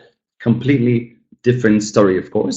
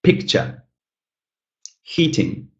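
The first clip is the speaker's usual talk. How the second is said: "Picture, heating" with PIC and HEAT stressed; first-syllable stress; 5 semitones higher, 3 dB quieter, slower than usual. Shown as a demonstration. Teacher showing students first-syllable stress in "Picture, heating". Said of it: "with the first syllable stressed".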